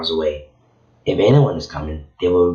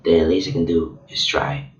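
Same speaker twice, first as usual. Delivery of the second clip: ordinary delivery, nothing marked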